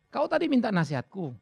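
ordinary talk

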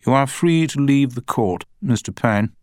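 high-pitched, measured judge's voice; courtroom judge. A narrator's reading. Judge dismissing the witness.

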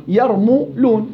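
"يرمو لون" slowly in parts, mu with a u vowel; mim with damma. Shown as a demonstration. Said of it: "الميم تقبل الثلاث حركات". («يرمو لون» بضم الميم مقطعة وببطء)

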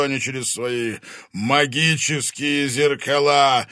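loud, slow, high booming dragon; menacing fire dragon voice; booming arrogant dragon. Acted. Dragon speaks to Angelina.

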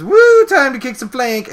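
very high, loud mock cheer; hammed-up high whoop; whooping battle cry. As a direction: imitate an eager fighter charging in. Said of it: "She says".

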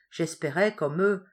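plain reading voice

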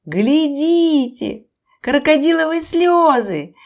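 loud, slow-starting mocking call; loud teasing cry; parrot's shout. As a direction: call everyone to look and jeer. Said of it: "крикнул пёстрый попугай".

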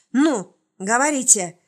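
loud, sharp growled order; growled command. A narrator's reading. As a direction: bark the order out. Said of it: "глухо рыкнув".

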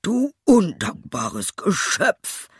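high, slow, outraged scolding; angry shout. A narrator's reading. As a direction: hurl the insult word by word. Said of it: "rief er". (wütendes Schimpfen)

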